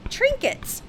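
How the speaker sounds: high, drawn-out trinkets; coy high request for trinkets; a spoilt daughter naming her wish, high and coy; Alice asking. A narrator's reading. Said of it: "said Alice".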